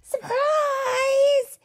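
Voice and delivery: very high shouted surprise; yelling. Acted. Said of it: "everyone yelled".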